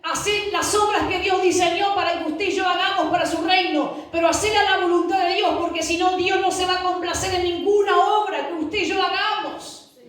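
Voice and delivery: high-pitched, urgent preaching; fervent exhortation. Real, not acted. Preacher urging the congregation to do God's will, fervent. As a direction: press the command home in a high, driving voice.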